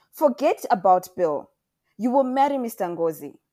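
loud, forceful order; stern command. A narrator's reading. Mother orders daughter to marry Mister Ngozi, stern command. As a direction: lay down the law at full voice.

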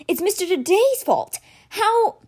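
put-on boy accusing his dead mentor, quavering with anger; quavering pent-up anger. A narrator's reading. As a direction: let the anger crack through. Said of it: "his voice quavered with pent-up anger".

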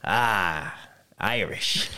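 put-on quiet old stranger's guess; a hint of a mad old guy, softly; lightly voiced old man. Old guy at an Indiana gas station, lightly imitated. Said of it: "a mad old guy came up".

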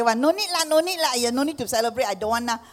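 lightly voiced Singlish auntie. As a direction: brush it off in Singlish. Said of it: "All Chinese people say".

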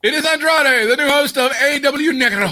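high, hammed-up announcer call. Podcast host introducing himself as an announcer, mock hype.

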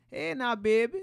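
put-on high, loud old Louisiana grandma voice; a doting grandmother from New Orleans; Grandma Agnes. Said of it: "Now, Grandma Agnes".

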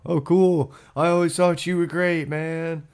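high, gushing mock welcome; fawning mock voice. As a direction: gush over the newcomer. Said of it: "Stuff like that'll happen".

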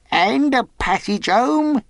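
high, slow, loud question; shrewd castaway. A narrator's reading. Castaway asks for passage home, shrewdly. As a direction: angle for it in a high, canny character voice. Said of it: "with a look of great shrewdness".

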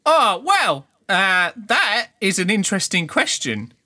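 put-on high, loud evasive kid; dodging the question; street kid voice.

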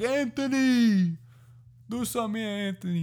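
very high, hammed-up dad voice; squawked-up mock dad cheer; TikTok dad impression. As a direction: imitate a dad calling to his kid at baseball. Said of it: "He's like".

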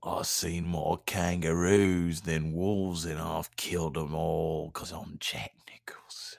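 slow whispered tough-guy boast; menacing whisper. Pretend. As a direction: breathe it low like a threat. Tough-guy Nicholson bit.

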